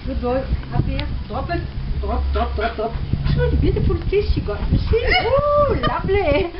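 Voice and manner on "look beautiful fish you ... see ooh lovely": high, loud gushing over the dog; excited praise. Real, not acted. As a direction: squeal with delight.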